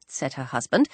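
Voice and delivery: ordinary delivery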